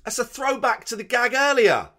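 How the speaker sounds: loud, high, delighted exclamation; excited shout. Real, not acted. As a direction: call out the discovery. Delighted at a callback gag.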